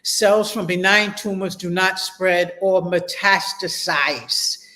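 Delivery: ordinary talk, nothing marked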